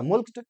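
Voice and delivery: मुल्क्ड with an audible k; k pronounced. Shown as a demonstration. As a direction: sound the k before the final t in मुल्क्ड. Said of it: "के का उच्चारण होता है". (मुल्क्ड में क का साफ़ उच्चारण)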